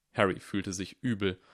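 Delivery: ordinary delivery, nothing marked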